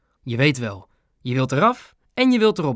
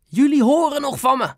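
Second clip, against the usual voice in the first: high-pitched indignant yell; flustered neighbour yelling, voice pitched up; shouted threat; 12 semitones higher than usual. A narrator's reading. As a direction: call back a threat on the way out. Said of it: "schreeuwde hij tegen oma en Ben".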